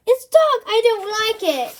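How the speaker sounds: high, loud wail in the dark; frightened wail. Acted. Boy under a cloak to Bertie, frightened wail. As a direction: whimper it out scared. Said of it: "wailed Eugene".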